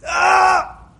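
short high-pitched "ah" yell; mock scream. Shown as a demonstration. Teacher showing what doesn't look right, screaming. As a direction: demonstrate an unrefined shout. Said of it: "not to scream".

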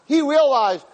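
ordinary delivery

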